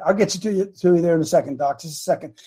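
ordinary delivery

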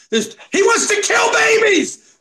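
high, slow shouted outrage. Real, not acted. Preacher shouting to the crowd about baby killing, loud and high.

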